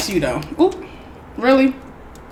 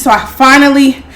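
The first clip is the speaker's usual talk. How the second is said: ordinary delivery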